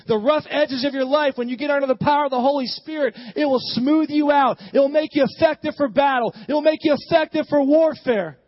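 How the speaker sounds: forceful sermon climax, punching each phrase; impassioned preaching. Real, not acted. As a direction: build each promise into a rallying cry.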